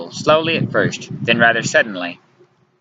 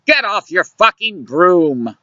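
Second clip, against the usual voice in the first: slow, higher exasperated shout; shouting back; 7 semitones higher, slower than usual. A narrator's reading. He answers Luciana from the stairs, shouting back. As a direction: throw it back, drawn out. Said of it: "I shout back".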